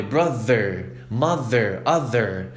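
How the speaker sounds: brother, mother, other with a buzzing voiced th; voiced th demo. Shown as a demonstration. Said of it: "a gente vai pro T H vibrado".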